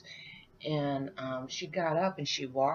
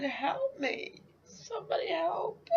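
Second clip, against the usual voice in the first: retold crying plea; 13 semitones higher than usual. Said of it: "and I'm screaming".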